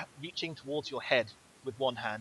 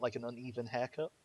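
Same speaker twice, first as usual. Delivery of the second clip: normal talking voice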